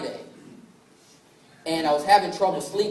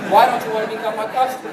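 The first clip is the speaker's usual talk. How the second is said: louder, higher mock protest; exasperated plea; 4 semitones higher, 6 dB louder, faster than usual. Grandson begging his grandparents to sign up, exasperated. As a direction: plead loudly, as in a retold argument. Pleading with grandparents who refused.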